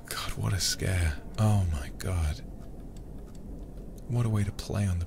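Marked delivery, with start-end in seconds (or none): none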